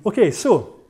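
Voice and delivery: normal talking voice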